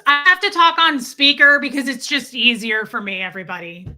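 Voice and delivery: mock-loud rude woman, easing off at the end; imitating loud speakerphone talker. Salon customer explaining her speakerphone to everyone, loud imitation. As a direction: announce it to the whole room, hammed up. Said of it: "loud behind me".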